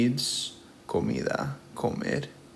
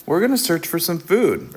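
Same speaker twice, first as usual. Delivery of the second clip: papa's serious, firm voice, pitched up and louder; 7 semitones higher, 5 dB louder than usual. Retold or imitated. Teacher voicing papa penguin, serious and firm. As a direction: say it like a determined father. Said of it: "in this voz seria".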